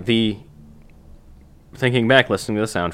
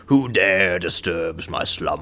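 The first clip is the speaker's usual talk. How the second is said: giant cave voice; slower than usual. Retold or imitated. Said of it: "what a good voice".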